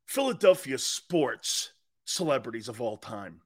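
usual speaking voice

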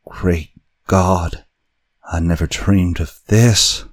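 fearful voice sinking into despair; hoarse with fear. A narrator's reading. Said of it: "still hoarse with the fear, now apparently tinged with despair".

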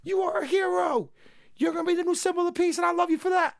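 root for him like a screaming fan; cheering fan. Retold or imitated. Host cheering Deku on as the new symbol of peace, hyped. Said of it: "And I'm like".

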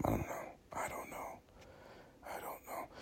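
fading to a whisper. Real, not acted. Podcaster trailing off to listeners, whispered. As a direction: drop to a breathless whisper.

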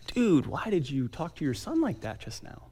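whispered, asking himself; self-reproach whisper. Retold or imitated. Father whispering to himself on the couch after yelling. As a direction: hush it, ashamed of yourself. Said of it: "I'm like".

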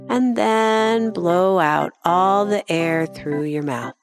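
usual speaking voice

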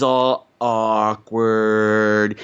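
very slow, low, stretched words; drawn-out awkwardness. Real, not acted. Recapping the awkward family introduction, slowly drawn out. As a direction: drag each word out for comic effect.

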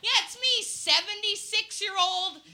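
high, loud, stretched-out put-on voice; hammed-up ageing songstress act; k.d. lang. Playing along as k.d. lang.

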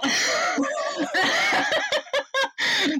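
high embarrassed laugh. Real, not acted. Host laughs at her own failed accent, embarrassed giggle. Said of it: "oh no, that didn't go well".

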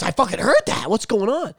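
alarmed roommate, retold. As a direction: react to a frightening bang. Retold alarm after the bang.

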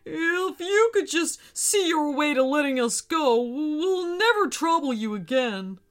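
flustered, pleading whale; spluttering. Acted. Whale begging shark to let them go, spluttering. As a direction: plead nervously for release. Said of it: "spluttered the whale".

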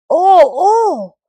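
loud, high moan of complaint; sky complains to the Shining One, loud and pained; complaining cry. Acted. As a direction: cry out in protest. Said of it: "complained the sky".

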